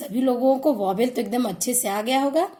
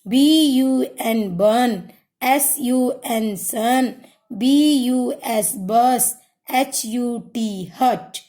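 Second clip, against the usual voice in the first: slow spelled letters, then the word blended; teacher leading a class in repeating after her; slow phonics drill; much slower than usual. Shown as a demonstration. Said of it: "phonic sound यू हम लोग कैसे पढ़ते हैं".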